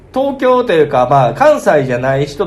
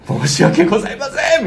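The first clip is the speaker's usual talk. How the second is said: flustered apology; 9 semitones higher than usual. Retold or imitated. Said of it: "女の子からしたらさ".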